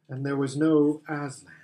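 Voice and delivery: ordinary delivery, nothing marked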